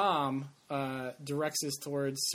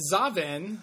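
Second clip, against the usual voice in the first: ordinary talk, nothing marked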